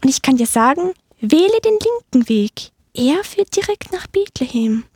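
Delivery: high little mouse voice; proud helper mouse. Acted. Mouse telling the donkey the way, high eager squeak. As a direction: pipe up helpfully as a tiny mouse.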